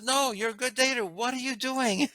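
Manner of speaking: high-pitched imitation of an amazed woman; amazed curiosity. Girlfriend to her good dater, acted amazed curiosity. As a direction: lift the pitch, stretch out the wondering question.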